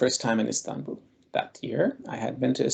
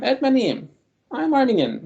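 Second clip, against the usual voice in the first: Ermeniyim said higher and louder, head up; confident imagined retort, said openly and proudly; 12 semitones higher, 3 dB louder than usual. Pretend. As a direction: declare it with pride, nothing to hide. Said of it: "Why didn't I just tell him".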